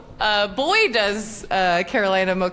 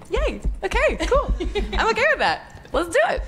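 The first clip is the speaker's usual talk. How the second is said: mock cheer; 11 semitones higher than usual. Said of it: "And I was like".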